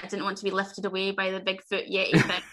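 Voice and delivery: ordinary talk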